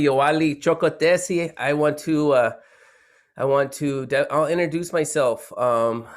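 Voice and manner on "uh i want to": normal talking voice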